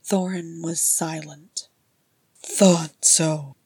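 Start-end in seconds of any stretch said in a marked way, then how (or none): 2.4-3.6 loud, harsh, whispered bitterness; savage misery. Acted. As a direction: hiss it in miserable scorn. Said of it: "said Balin with savage misery".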